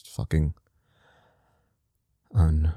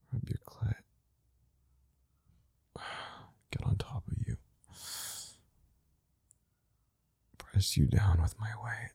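whispering; 4 semitones higher, 11 dB quieter than usual. Acted. Intimate whispered audio.

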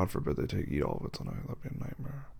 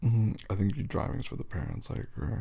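ordinary delivery

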